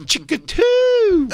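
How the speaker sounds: very high, drawn-out squawk; silly noise cry. Pretend. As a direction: squawk it long, loud and very high. Making silly noises with Jacob.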